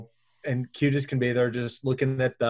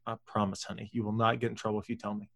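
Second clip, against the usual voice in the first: soft reassurance; 2 semitones lower, 8 dB quieter, faster than usual. Retold or imitated. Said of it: "I'm like".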